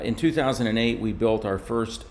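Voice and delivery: ordinary delivery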